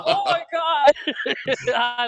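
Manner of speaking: high, loud gasp through laughter; real helpless laughter; cracking up. Real, not acted. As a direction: cry it out mid-laughing fit. Still cracking up at the memory.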